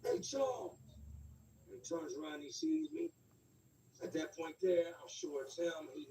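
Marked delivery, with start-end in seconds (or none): 0.0-0.8 a high call across the street; yelling a name. Retold or imitated. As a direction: call him out from far away. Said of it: "I yell in the street".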